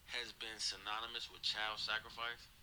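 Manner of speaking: ordinary talk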